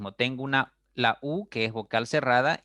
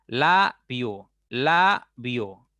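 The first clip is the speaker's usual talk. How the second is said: "labio" as loud la, then bio; syllable by syllable; 5 dB louder, much slower than usual. Shown as a demonstration. Teacher showing two syllables, "labio" firmly split la, bio. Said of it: "Tiene dos sílabas esta palabra".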